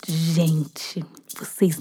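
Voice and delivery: unvoiced aside whisper. Real, not acted. Storyteller whispers to listeners before a surprise. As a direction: lean in and whisper to the audience. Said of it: "whisper aside".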